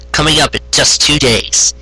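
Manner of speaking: ordinary delivery, nothing marked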